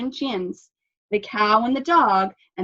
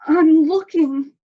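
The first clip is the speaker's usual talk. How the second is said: stammering; 8 semitones higher, 8 dB louder, slower than usual. A narrator's reading. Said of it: "Cow stammered".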